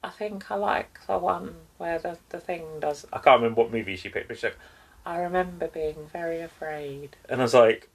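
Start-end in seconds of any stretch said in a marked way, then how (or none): 0.0-3.1 a hint of a soft, higher, quiet doll voice; mock Mia Goth interview voice. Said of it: "she sounds completely different". 5.1-7.2 a hint of a quiet, higher haunted doll voice; mock Mia Goth voice. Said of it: "a little haunted Victorian doll".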